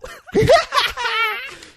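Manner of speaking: loud, very high crazed cackle; hysterical laughter. Retold or imitated. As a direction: burst into mock-hysterical laughing. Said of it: "she's laughing".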